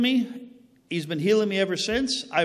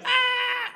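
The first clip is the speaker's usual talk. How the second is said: scream of pain. Retold or imitated. Man hit by sudden back pain in a stranger's house, high scream. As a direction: cry out as the pain shoots up the spine. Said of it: "I'm screaming".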